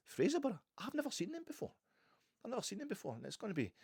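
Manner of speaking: high, eager and delighted; a fan thrilled about a new opponent, pitch up high; excited. Real, not acted. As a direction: bubble over about the new fixture.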